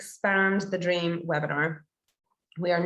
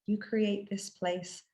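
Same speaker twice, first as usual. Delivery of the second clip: guided meditation; 4 dB quieter than usual. Acted. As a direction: guide listeners gently inward, phrase by unhurried phrase.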